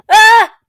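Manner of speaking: short, high, loud scream; sharp burst of mock panic; panicked scream. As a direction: yelp in horror. Panics at talking to the dead.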